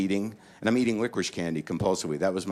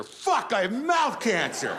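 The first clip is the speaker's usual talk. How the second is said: a much higher, slow, hammed-up furious cry; mock anger; 13 semitones higher, slower than usual. Comic acting out the anger stage, hammed-up fury. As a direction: ham up the rage of a doomed man. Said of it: "Then anger".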